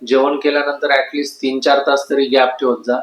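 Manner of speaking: ordinary delivery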